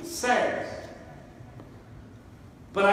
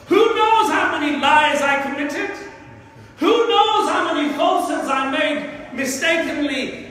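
high, impassioned preaching; emotional shouting; 4 semitones higher than usual. Real, not acted. Preacher confessing his tongue's faults to congregation, emotional. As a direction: pile up the questions with rising fervour. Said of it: "shout so emotionally like I am".

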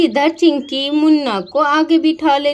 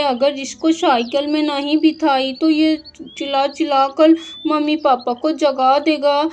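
ordinary talk

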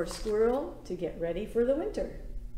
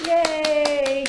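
cheer; 4 semitones higher, 8 dB louder than usual. Real, not acted. Cheering kids after song.

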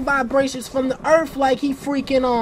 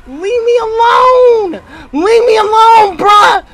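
loud, high, pleading yell; exasperated, panicky cry; desperate shout; 7 semitones higher, 7 dB louder than usual. Real, not acted. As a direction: shout the chaser away. Begging pursuer to stop.

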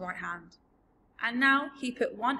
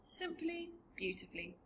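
put-on high, very soft little-girl voice; hushed, heartfelt praise; tender sincerity; 8 semitones higher, 11 dB quieter than usual. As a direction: repeat the compliment gently. Sophie repeats her compliment tenderly.

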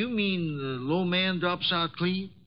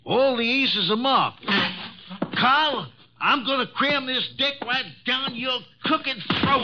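higher, furious threat at a cheat; angry accusation; 6 semitones higher than usual. Acted. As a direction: go for his throat in rage. Mitch discovers cheating, threatens Carl.

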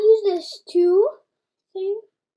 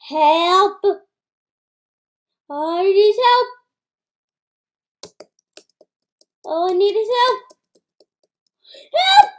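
yelling for help; 6 dB louder than usual. Real, not acted. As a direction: scream for help, rising. Cries for help in game.

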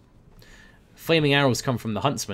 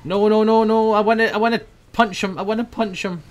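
higher, louder pleading, fading at the end; urgent protest; 9 semitones higher, 6 dB louder than usual. Real, not acted. Gamer desperate to land a punch, urgent protest. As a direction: beg to get the punch in.

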